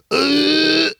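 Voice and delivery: high, drawn-out toothless "ugh"; toothless groan. Retold or imitated. Mocking toothless locals on Blackpool Pier, high groan. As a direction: mimic toothless people with a high noise. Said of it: "toothless people going".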